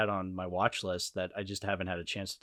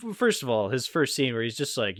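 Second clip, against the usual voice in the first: ordinary delivery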